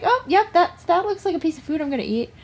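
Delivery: high, eager little pet voice; squeaky, excited rodent chatter; rat voice. Pretend. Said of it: "They're just like".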